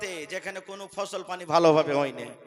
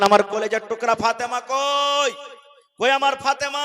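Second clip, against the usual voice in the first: raised preacher's cry; calling out; 5 semitones higher, 8 dB louder than usual. Acted. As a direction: call out searchingly, holding the last syllable. Prophet voiced calling for his daughter.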